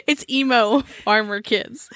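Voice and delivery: usual speaking voice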